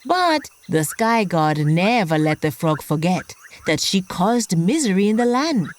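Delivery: usual speaking voice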